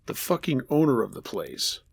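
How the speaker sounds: ordinary delivery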